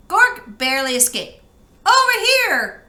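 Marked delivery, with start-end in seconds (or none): 1.8-2.8 high, loud call across space. Acted. Bork calling to her fleeing brother, loud and high. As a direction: shout it to be found, much higher and louder. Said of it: "called Bork".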